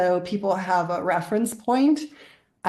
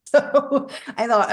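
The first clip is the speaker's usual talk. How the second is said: laughing; 6 semitones higher, 4 dB louder than usual. Real, not acted. Laughs recalling the two crows.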